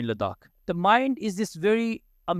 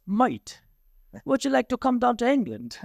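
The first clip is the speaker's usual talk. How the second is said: light imitation of an English accent, pitched higher; 6 semitones higher than usual. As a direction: lightly put on English accent, friendly invitation. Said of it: "He said to me in his English voice".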